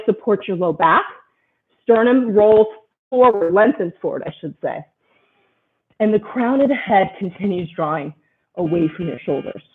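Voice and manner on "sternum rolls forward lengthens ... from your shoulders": ordinary talk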